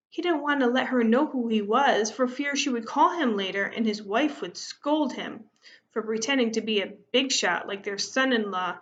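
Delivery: ordinary delivery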